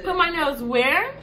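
incredulous question. Retold or imitated. Customer baffled by the manicurist's instruction, incredulous. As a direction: put my nails WHERE. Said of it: "when she said".

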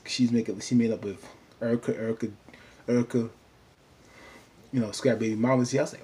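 ordinary talk, nothing marked

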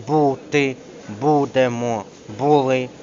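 БУТИ, БУДЕМО, БУЛИ each with a long stressed БУ. Shown as a demonstration. Teacher drilling verb forms, stress on U. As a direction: hit the first syllable БУ in every word. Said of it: "наголос на «у»".

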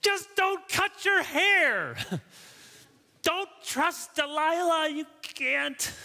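high, drawn-out cry of exasperation; exasperated plea. Pretend. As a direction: plead in a high stretched voice, as if begging Samson to stop. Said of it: "don't you want to say".

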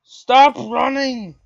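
frustrated gamer yell; exasperated shout. Real, not acted. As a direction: holler slowly at a fleeing opponent. Yelling at fleeing game opponent.

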